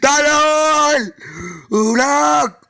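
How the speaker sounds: haul him back with a long call; drawn-out call. Acted. Chasing Jerry, calling him back.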